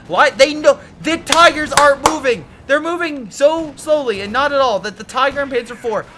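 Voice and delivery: high, loud furious outburst; raging. Real, not acted. Caster raging at viewers about unmoving Tigers, loud and furious. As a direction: rant in real rage at the idle tanks. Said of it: "Oh, I'm raging".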